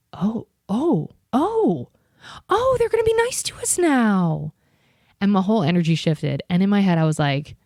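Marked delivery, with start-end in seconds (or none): 0.1-4.5 much higher, sing-song mock-naive voice; mock naive delight. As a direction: play your own gullible hope, sweetly. Mocking own naive hope.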